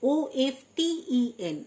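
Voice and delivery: ordinary talk, nothing marked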